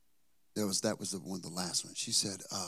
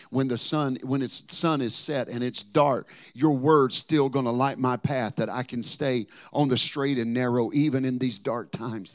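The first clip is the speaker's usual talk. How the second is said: full-force preaching; 6 dB louder than usual. Acted. Preaching climax.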